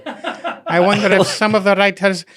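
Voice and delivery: high, loud, laughing fake Irish lady; laughing through a failed Irish lady voice; bad Irish accent. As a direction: ham up a terrible Irish brogue, cracking up. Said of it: "I don't even know what that accent was".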